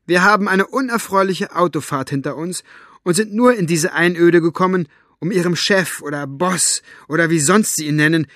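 high-pitched, forceful telling-off; indignant tirade. A narrator's reading. Elinor demanding manners from the boss's henchman, indignant tirade. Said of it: "fuhr Elinor Buster an".

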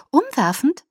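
high, loud, excited question; delighted. A narrator's reading. Alice asks how Bella likes the cottage, delighted. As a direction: burst out with the eager guess.

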